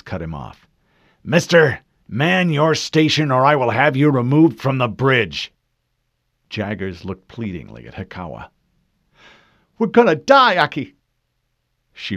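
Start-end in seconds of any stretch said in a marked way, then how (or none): 1.2-5.5 loud, hard command; stern threat. Acted. Commander threatens panicking officer. 9.8-11.0 high, loud panicked plea; panicked cry. Acted. Panicked officer pleads.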